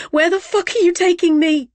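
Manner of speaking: high, loud shrieked demand; screeching. Acted. Captive woman screeching at her abductor where they are going. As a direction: screech it in raw panic. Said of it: "I screech".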